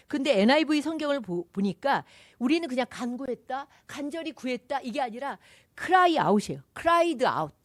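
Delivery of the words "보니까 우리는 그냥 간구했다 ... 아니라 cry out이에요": ordinary delivery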